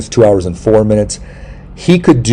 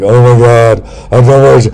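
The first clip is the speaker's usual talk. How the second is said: mock dread; 3 semitones higher, 5 dB louder than usual. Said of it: "I'm like".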